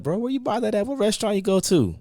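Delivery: amazed asking. Retold or imitated. Quoting himself asking his friend about his food, pitched high in amazement. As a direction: ask like you can't believe a home cook made it. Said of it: "I be like".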